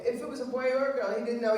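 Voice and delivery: ordinary talk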